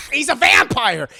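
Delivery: loud alarmed cry; shocked shout. Retold or imitated. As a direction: yell it out in disbelief. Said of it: "and he's like".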